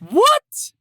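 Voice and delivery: piercingly high, loud "what"; shocked shout. Real, not acted. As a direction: shriek it in utter disbelief, loud enough to clip. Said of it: "I just clipped so hard".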